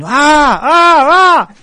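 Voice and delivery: loud, high drawn-out "aah" yells; imitated shouting. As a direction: mimic a man shouting wordless cries to scare someone. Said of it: "he started shouting".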